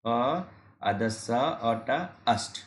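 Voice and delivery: अस्ट broken into separate sounds अ, अद, स, अ, ट, then blended as अस्ट; sounding out. Shown as a demonstration. Teacher sounds out अस्ट sound by sound for students. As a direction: split अस्ट into its sounds, then join them as अस्ट. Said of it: "इसको बोलेंगे अस्ट".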